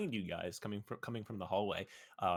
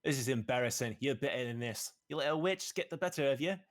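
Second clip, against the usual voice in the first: loud, higher scolding; scolding knight; 6 semitones higher, 6 dB louder than usual. Acted. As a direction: scold him like a disappointed superior. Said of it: "looks down at the man and says".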